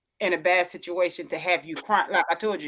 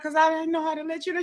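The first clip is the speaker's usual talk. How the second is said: quick excuse; 8 semitones higher, faster than usual. Retold or imitated. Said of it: "She was like".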